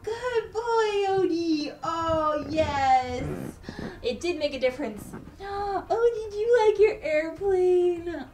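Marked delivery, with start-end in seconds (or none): 0.0-3.2 tender praise. Real, not acted. Praising Odie for his choice, high tender baby talk. 5.4-8.2 overjoyed. Real, not acted. Gushing to Odie about his airplane, high overjoyed baby talk.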